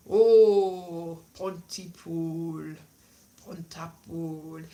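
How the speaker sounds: put-on loud 'oh', then low, slow Newport accent; local Welsh accent. Comic imitating locals naming their town, slow Welsh accent. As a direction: drone the place name in a Newport accent. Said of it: "that is the accent that they say".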